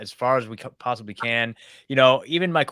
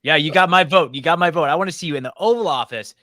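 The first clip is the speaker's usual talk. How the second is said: excited cheer; 4 semitones higher, 8 dB louder than usual. Real, not acted. Host cheers guest on excitedly.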